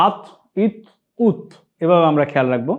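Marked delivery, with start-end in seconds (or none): 0.1-1.4 echoing release after each t of "আত, ইত, উত"; qalqalah. Shown as a demonstration. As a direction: bounce the final t in "আত, ইত, উত". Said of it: "সাকিন হলে কলকলা করে পড়তে হয়". (কলকলা করে "আত, ইত, উত")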